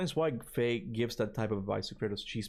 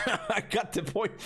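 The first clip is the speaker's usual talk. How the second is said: high-pitched laughing protest; amused, breaking up while talking; 10 semitones higher, slower than usual. Real, not acted. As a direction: say it through a burst of laughter. Amused at a long script.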